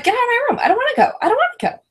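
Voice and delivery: high, whining get out of my room; whiny refusal. Retold or imitated. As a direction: complain like a sulky kid in bed. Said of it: "Vicky was like".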